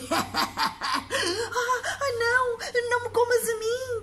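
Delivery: high, loud, stammering terror; scared child. Acted. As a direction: squeal in fright like a small boy. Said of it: "disse o Mateus".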